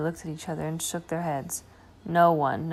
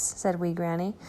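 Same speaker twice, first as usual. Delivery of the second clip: plain reading voice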